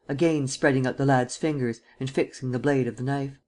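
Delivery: ordinary delivery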